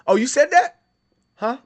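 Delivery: high, loud disbelief; acted astonished sibling. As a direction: play the amazed little brother. Voices the brother's astonished reply.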